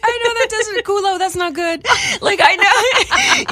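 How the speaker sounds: laughing out loud. Real, not acted. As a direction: giggle helplessly while telling it. Laughing while retelling Italian swearing.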